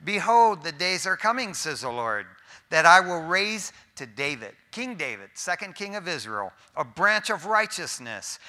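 ringing pulpit reading of scripture; sermon scripture reading; preaching scripture. Acted. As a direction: proclaim the verse to the congregation.

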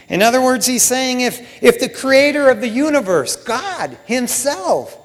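loud, high, slow-stretched sermon climax; full-force preacher; impassioned preaching. Real, not acted.

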